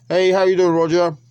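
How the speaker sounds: loud, high greeting across the room; calling out. Real, not acted. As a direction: call out to someone arriving. Greeting roommate coming in.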